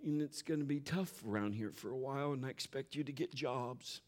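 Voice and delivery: put-on hushed, serious talk to the kids; a hint of a solemn parent; mock grave parent talk. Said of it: "I'm not gonna say".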